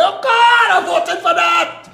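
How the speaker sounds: hammed-up shout of regret; mock voter lament. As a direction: wail it like a duped voter. Mocking a regretful PNM voter.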